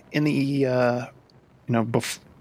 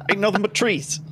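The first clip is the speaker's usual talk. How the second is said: higher-pitched Cockney raven; heavy Cockney; 9 semitones higher than usual. Acted. Raven scout reporting to the party, heavy Cockney. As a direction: report back in comic broad Cockney. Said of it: "he has a heavy Cockney accent".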